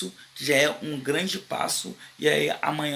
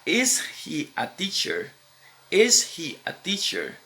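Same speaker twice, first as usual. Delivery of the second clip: slow question model; slower than usual. Shown as a demonstration. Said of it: "eu vou falar".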